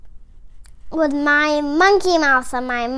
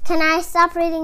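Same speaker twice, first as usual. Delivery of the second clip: ordinary delivery, nothing marked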